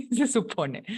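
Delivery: real chuckle leading into the words; amused laugh. Real, not acted. Teacher apologizing to students for messy mouse handwriting, chuckling. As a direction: laugh at yourself, then speak through it.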